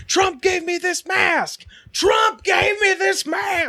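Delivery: high, slow shouted rant; imitated shout. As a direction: bellow it like the raging woman. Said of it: "she's just like".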